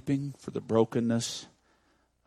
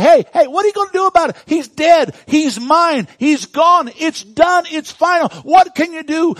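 high, loud, fast jeering devil; mock him like a gloating devil; loud, fast sneering taunt; 13 dB louder than usual. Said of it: "The devil was probably saying".

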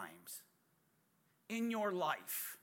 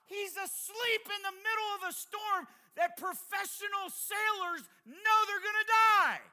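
higher, louder, building sermon climax; full-force preaching; 14 semitones higher, 7 dB louder than usual. Real, not acted. Preacher pressing the congregation about storms, at full force. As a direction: drive it home like a revival preacher.

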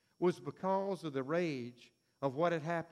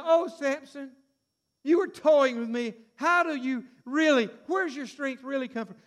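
Delilah pleading; 6 semitones higher, 6 dB louder than usual. Retold or imitated.

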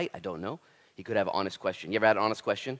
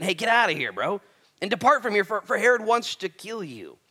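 louder, urgent warning to leave; Pharisees' warning; 8 semitones higher, 7 dB louder than usual. Acted. Pharisees warning Jesus to leave, loud and urgent. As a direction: urge him to get out now.

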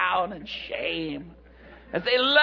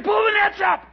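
high, urgent shout; excited command; 10 semitones higher, 4 dB louder than usual. Acted. Peter to crew with full nets, excited shouting. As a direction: holler the order, thrilled.

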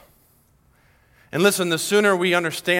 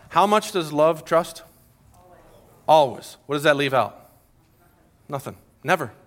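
normal talking voice

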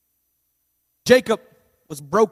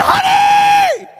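very high, loud yell for his wife; frantic call; 9 dB louder than usual. Retold or imitated. Husband bursting in to his wife, frantic high yell. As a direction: shout for her across the house.

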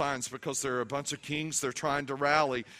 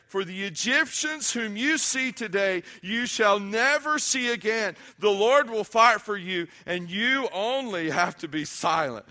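high, loud preacher's declamation; ringing, emphatic scripture reading; preaching scripture; 7 semitones higher, 6 dB louder, slower than usual. Acted. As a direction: proclaim the verse to the congregation, leaning on key words.

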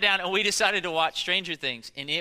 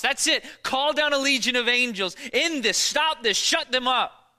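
high loud urgent shout; yelling at the Bible; 9 semitones higher, 7 dB louder than usual. Retold or imitated. Preacher yelling at the crucifixion scene, loud shout. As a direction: demand it stop, full voice. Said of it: "I wanna yell at the Bible".

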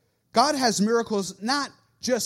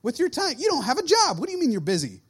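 higher, louder incredulous mock rant; hammed-up disbelief at their excuse; mock exasperation; 6 semitones higher, 4 dB louder, faster than usual. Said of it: "It's like".

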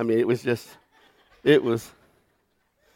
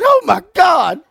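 much higher, louder gasp of shock; shocked exclamation; 13 semitones higher, 6 dB louder than usual. Retold or imitated. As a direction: blurt it out in sudden disbelief. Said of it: "just leaning over going".

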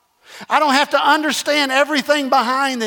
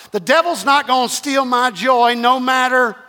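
ordinary talk, nothing marked

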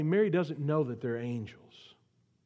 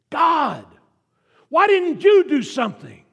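much higher and louder, crying out; anguished cry to God; 10 semitones higher, 12 dB louder than usual. Retold or imitated. Mary at the empty tomb, to God, anguished cry. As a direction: hurl it up at heaven in grief. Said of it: "Think what must have been running through her mind".

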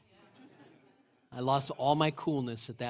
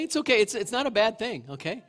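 it's okay pitched much higher and louder; emphatic reassurance; 6 semitones higher, 5 dB louder than usual. Real, not acted. Preacher assuring the cautious ones, a high, loud it's okay. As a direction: burst out with it's okay to comfort them. Reassures non-risk-takers.

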